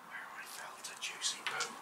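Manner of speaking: whispered aside; hushed cheeky murmur; whispering. Real, not acted.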